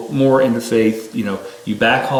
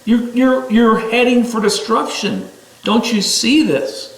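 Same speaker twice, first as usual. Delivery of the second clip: much higher, urgent and pleading; pleading yell; 9 semitones higher than usual. Retold or imitated. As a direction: cry out so they finally listen. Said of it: "but you're screaming".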